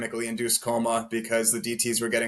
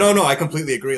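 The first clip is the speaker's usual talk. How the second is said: emphatic agreement; 3 semitones higher, 8 dB louder, much faster than usual. Retold or imitated. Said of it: "And I said".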